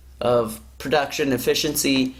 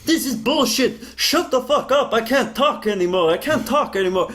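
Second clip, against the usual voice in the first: higher, hammed-up whiny rant; mock temper tantrum; 6 semitones higher than usual. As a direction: play an old man's tantrum, half-mocking. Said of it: "Roger has this whole temper tantrum".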